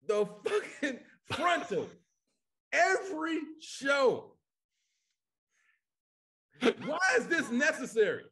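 high, exasperated shout; exasperated outcry. Real, not acted. Comedian rants about nudity in every show, exasperated. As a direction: cry out in comic disbelief.

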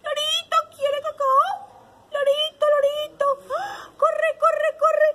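high, loud parrot squawk. Acted. Storyteller showing children how a parrot talks, squawky. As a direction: call out like a chattering pet parrot. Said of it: "¿Cómo es que es el lorito?".

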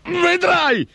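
high-pitched ogre threat; threatening, foaming rage. A narrator's reading. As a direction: threaten furiously in a high creature voice. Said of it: "minacciò l'omone schiumante di rabbia". (minaccia rabbiosa, voce acuta da orco)

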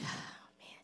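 acted-out sigh on a whispered breath; weary sigh. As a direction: let the words out as a soft sigh. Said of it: "he sighed".

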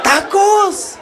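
"¡Tacos!" cantado muy agudo; pregón de taquero. Retold or imitated. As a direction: anunciar los tacos como vendedor. (taquero pregonando tacos, muy agudo)

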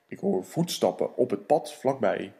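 low, deep monster rumble; Gruffalo voice. Acted. Gruffalo sizing up his dinner, low and deep.

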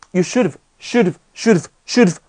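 "should've" squeezed into one unit, "shoud-v"; contracted reading. Shown as a demonstration. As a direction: shrink "have" to a bare v on "should've". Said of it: "缩读".

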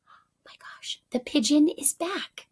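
hushed excited whisper. Real, not acted. Teacher tells the class the pigeon is back, whispering.

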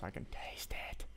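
hushed, eager whisper; tense whisper, nearly there. Real, not acted. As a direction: whisper it like it's almost yours. Close to winning, hushed.

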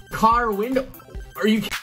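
ordinary delivery

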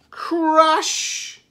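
high, drawn-out storybook crash; loud crash word. A narrator's reading. Reader voices the tower collapsing, loud and dramatic. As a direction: call out the crash like a sound effect.

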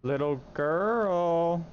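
slow, drawn-out call to a ghost; creepy calling. Acted. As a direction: call out slowly into the dark.

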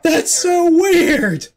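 high, slow laughing exclaim; laughing disbelief. Real, not acted. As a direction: laugh it out in amazement. Sees friend's ghost flying.

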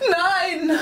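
high shrieking no; grandma screaming as the child touches the light; grandma screams. Acted. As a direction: scream it like a terrified granny. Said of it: "doch Oma schreit".

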